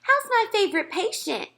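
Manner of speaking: high, gushing nurse voice; nurse's voice. A narrator's reading. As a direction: coo over the little boy. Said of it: "she said".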